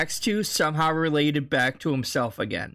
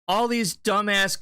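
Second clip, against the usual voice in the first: usual speaking voice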